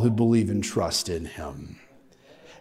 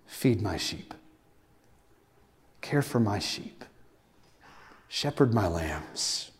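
gentle Jesus; 3 semitones lower, 7 dB quieter than usual. Retold or imitated. Jesus gently restores Peter.

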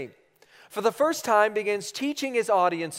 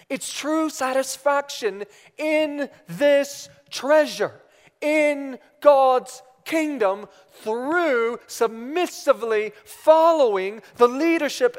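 high, loud, slow proclamation; raised, forceful pulpit delivery with weighty pauses; emphatic preaching; 6 semitones higher, 5 dB louder than usual. Real, not acted. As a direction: preach it out word by word.

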